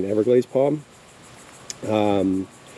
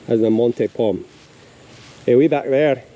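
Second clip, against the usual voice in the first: put-on Scottish accent; 4 dB louder than usual. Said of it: "I wanna say this tree in a Scottish accent".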